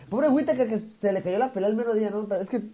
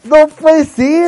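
drawn-out exasperated shout; 6 semitones higher, 15 dB louder, much slower than usual. Real, not acted. Exasperated at late friend.

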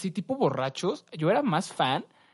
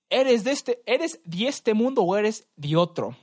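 raised, loud, slow mock challenge; man questioning a demon; 6 semitones higher, 4 dB louder, slower than usual. Retelling the video's man to demon, mock dramatic questioning. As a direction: put the question slowly and loudly, pitched up.

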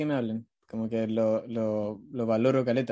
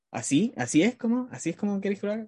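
higher-pitched, questioning, in-character voice; inquisitivo; 6 semitones higher than usual. Retold or imitated. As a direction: probe as if playing a part. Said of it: "soy inquisitivo". (voz inquisitiva, más aguda)

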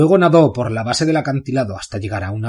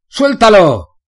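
high, loud guard's yell; shout; 9 semitones higher, 9 dB louder, much slower than usual. Acted. Guard on the wall yelling at Bane to let go. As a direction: scream the order from the wall. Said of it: "gritó alguien".

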